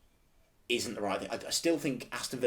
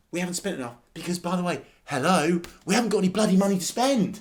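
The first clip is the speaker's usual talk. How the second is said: high, loud frustrated argument; worked-up, emphatic protest; exasperated rant; 6 semitones higher, 5 dB louder than usual. Real, not acted. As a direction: argue it heatedly, voice raised. Heated rant about club spending.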